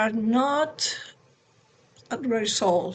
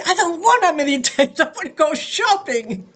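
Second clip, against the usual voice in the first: put-on higher and louder, whiny and childish, breaking into a laugh; petulant refusal; 6 semitones higher, 6 dB louder than usual. Tired mother refusing her husband's advice, mock-whiny and laughing. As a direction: sulk like a kid refusing chores.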